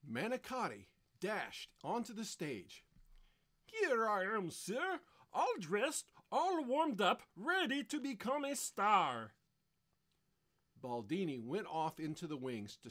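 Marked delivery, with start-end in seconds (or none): 3.6-9.3 high, loud, showy tenor; eager understudy. Acted. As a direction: announce yourself like a would-be star. Eager understudy presents himself.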